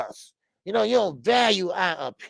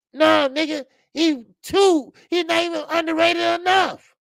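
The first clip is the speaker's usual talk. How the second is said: high, louder sarcastic scoff; mocking exclaim; 13 semitones higher, 5 dB louder than usual. Real, not acted. Host mocking co-host's claim that Rolly is underrated. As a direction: ridicule the claim with a raised voice.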